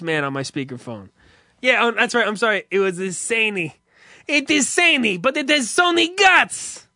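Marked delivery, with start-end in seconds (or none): 1.6-6.9 high put-on clerk voice, louder on Sony guts; phone vendor voice. Comedian imitates the vendor on speakerphone in a high voice. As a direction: echo the movie clerk as the phone guy. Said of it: "that's the exact voice I heard".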